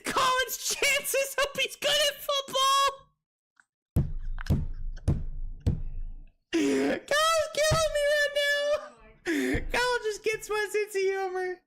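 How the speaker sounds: laughing. Real, not acted. Cracking up at Kyle's joke.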